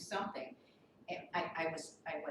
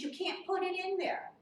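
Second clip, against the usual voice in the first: heated retort; 10 semitones higher, 4 dB louder than usual. Retold or imitated. Arguing with the tenant.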